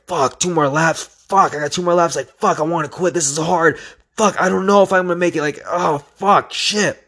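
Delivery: high, strained swearing to himself; acted-out despair. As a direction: relive the panic of laps still left. Acting out struggle with laps left.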